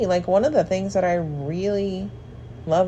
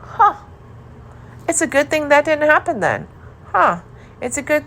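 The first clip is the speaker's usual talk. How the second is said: higher-pitched acted realization; pondering in hindsight; 9 semitones higher, 4 dB louder than usual. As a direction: act out someone realizing it in hindsight. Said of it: "look back and think".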